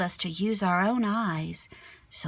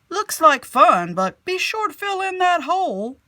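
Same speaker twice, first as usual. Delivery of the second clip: man walking by; 10 semitones higher, 10 dB louder than usual. Acted. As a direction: call over kindly to the kid. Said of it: "said a man walking by".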